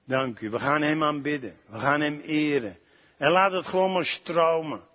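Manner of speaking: soft, low worship voice that sinks to a hush; worship prayer. Acted. Preacher leading the congregation in worship, soft and low. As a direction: lead the worship gently over the music.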